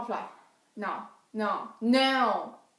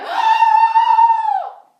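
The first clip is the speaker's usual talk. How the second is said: piercing high decoy scream; fake scream; 16 dB louder than usual. Girl faking a scream to distract the ladies, piercing scream. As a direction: scream to make them look away. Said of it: "je fais".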